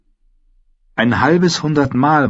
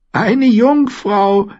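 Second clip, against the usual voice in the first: hoch und sehr langsam gedehnt, spöttisch; neckend; 4 dB louder, much slower than usual. A narrator's reading. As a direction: zieh ihn genüsslich auf. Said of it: "neckte sie ihn". (neckend, hoch und gedehnt)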